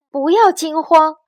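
high, loud command; shout. A narrator's reading. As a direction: call out to steady the crowd. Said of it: "大喊道".

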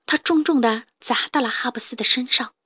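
usual speaking voice

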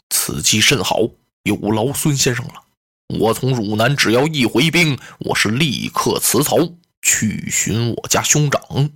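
low, measured general's voice, slowing at the end; a dignified general speaking gravely; low, weighty heroic general; Guan Yu's voice. Acted.

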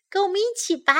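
high-pitched wife's voice; urging wife. A narrator's reading. Farmer's wife asking for help pulling, high urging voice. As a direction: pitch it up to invite them in. Wife urges helper to pull.

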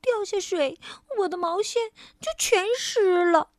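high, anxious little mouse; trembling fright. A narrator's reading. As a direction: fret with a frightened shiver. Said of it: "多多惊得直发抖".

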